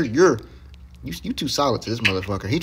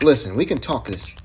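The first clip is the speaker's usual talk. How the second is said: normal talking voice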